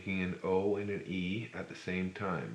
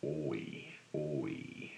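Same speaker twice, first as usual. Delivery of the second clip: "Oi, oi" dropped low and soft, the last one breathed; soft whispered oi demo; 3 semitones lower, 7 dB quieter than usual. Shown as a demonstration.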